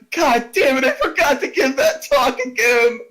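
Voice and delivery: a touch of self-annoyed groan; mock exasperation. Imitating the GM cutting back to last session, mild mock exasperation. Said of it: "he's like".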